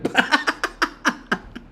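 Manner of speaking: high, silent wheezing laugh; airy high giggle; breathless laugh. Real, not acted. Cracking up at chat.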